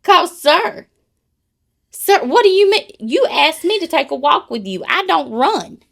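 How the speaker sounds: huffy mock protest; indignant. To a tall walking partner, in loud mock outrage.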